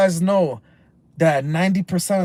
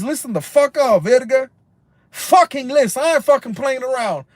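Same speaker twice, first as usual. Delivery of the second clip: louder, harder warning to the chat; real anger building at drinkers; angry scolding; 5 semitones higher, 4 dB louder than usual. Real, not acted. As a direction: scold them loudly. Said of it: "I'm getting angry".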